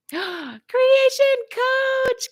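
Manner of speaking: gasping, very high and slow greeting; surprised. Real, not acted. Speaker greets the creation coach in surprise, gasping. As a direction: caught off guard mid-improv. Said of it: "surprise at seeing coach".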